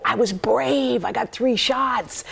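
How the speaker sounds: a hint of a proud little girl; child's pride. Daughter quoted proudly.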